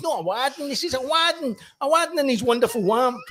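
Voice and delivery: Bobby insisting on "Aladdin" with W sounds; Bobby correcting his friend, R's as W's; can't say his R's. Retold or imitated. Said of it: "couldn't pronounce his R's".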